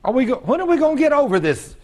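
high, insistent preaching; exasperated plea. Real, not acted. Preacher challenging his church about complaining, high exasperation. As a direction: push it up in exasperation.

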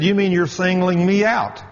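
imitating a worker's whiny protest. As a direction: mimic a defensive young worker. Worker protests being singled out.

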